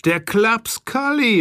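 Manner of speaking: „Der Klaps Kalli“ hoch und gedehnt ausgerufen, plötzliche Erkenntnis. A narrator's reading. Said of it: "rief ich". (Erzähler ruft den Namen des Täters aus, hoch und gedehnt)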